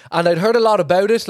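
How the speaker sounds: ordinary talk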